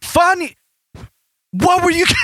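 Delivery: very high mock British accent. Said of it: "It's fucking British accent".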